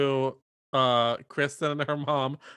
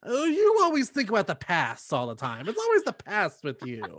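mock bickering daughter and mom; 8 semitones higher, 7 dB louder than usual. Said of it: "They're like".

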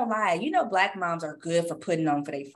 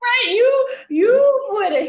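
laughing hard; 9 semitones higher, 5 dB louder than usual. Real, not acted. Laughing at dad's joke.

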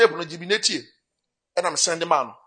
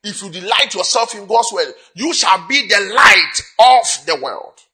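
slow, ringing declaration building louder; emphatic preaching declaration; 3 semitones higher, 6 dB louder, slower than usual. Real, not acted. Preacher declaring God's promise to the congregation, emphatic and building. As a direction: proclaim it slowly, swelling on the last promise.

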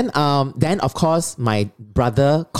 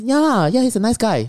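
brother's approval; 10 semitones higher than usual. Retold or imitated.

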